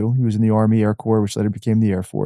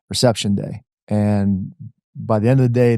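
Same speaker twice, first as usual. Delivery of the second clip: ordinary delivery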